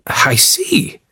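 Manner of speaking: breathless excitement. Acted. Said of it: "with a lot more excitement in his voice".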